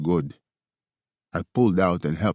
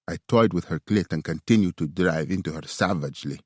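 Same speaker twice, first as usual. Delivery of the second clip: ordinary delivery, nothing marked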